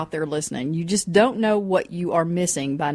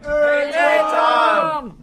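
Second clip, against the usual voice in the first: high, drawn-out cheery holler; group-greeting shout; 7 semitones higher than usual. Real, not acted. Everybody greets buddy Tom on cue, shouting out.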